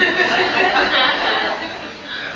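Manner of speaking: a high, real laugh at fumbling the word; amused laugh. Real, not acted. Laughing at her own stumble over the Pali word, amused. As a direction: laugh it off after tripping over the term.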